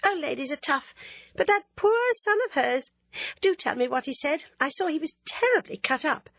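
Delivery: a coquettish schoolgirl needling her friend; teasing Ginevra. Acted.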